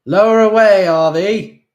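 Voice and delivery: calling to someone above; shouted. A narrator's reading. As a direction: yell it up through cupped hands. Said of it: "shouted at the ceiling".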